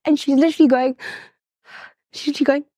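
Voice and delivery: high, strained voice choking up; real tearful. Real, not acted. Mother retelling her daughter's brave goodbye, tearful.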